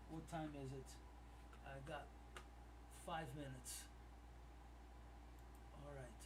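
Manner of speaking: low, soft, absorbed murmur; subdued thinking aloud. Real, not acted. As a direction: think aloud quietly while checking. Checking numbers, thinking to himself.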